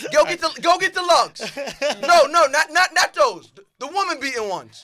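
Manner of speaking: high and loud, laughing through it; laughing through a hammed-up order; laughing bit. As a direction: crack up while playing the joke. Acting out a joke, laughing.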